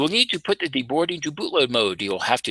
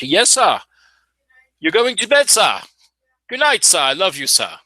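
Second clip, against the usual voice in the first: playful goodnight to son; 8 semitones higher, 4 dB louder than usual. Real, not acted. Sending son to bed.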